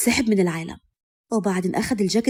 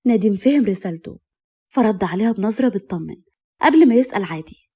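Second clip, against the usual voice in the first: plain reading voice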